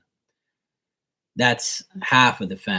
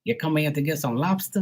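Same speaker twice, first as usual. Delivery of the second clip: higher-pitched mock Boston accent; 5 semitones higher than usual. Bostonians greeting their visiting cousin, mock Boston accent. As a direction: imitate Bostonians with dropped Rs. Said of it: "in Boston, they talk like".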